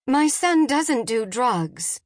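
high, slow, emphatic protest; shocked mother defending her son. A narrator's reading. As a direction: insist slowly, appalled at the question. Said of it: "Jen was shocked".

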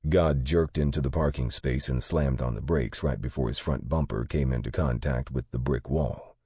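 ordinary delivery

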